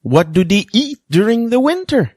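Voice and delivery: ordinary talk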